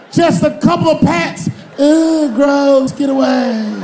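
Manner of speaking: high, shouted pleading and squeals; desperate superhero begging, woman shrieking back; pleading hero and disgusted woman. Acted. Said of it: "convince women".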